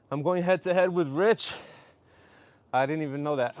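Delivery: normal talking voice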